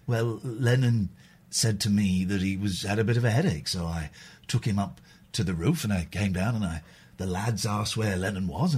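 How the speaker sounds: lightly voiced posh older gent, low and hushed; a gentle hint of a posh record producer telling an old anecdote; George Martin impression. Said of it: "I bet I could do a George Martin. He's very posh".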